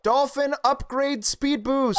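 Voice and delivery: high slow hype call; excited announcer. Acted. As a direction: announce it like a power-up. Game buff announced.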